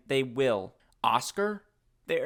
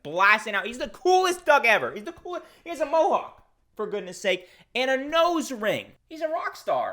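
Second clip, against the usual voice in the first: much higher, louder fan gushing; excited gushing; 9 semitones higher, 4 dB louder than usual. Real, not acted. Vlogger gushing over the punk rock duck, high and excited. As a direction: rave about him like a starstruck fan.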